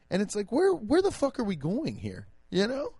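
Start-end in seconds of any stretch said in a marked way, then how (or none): none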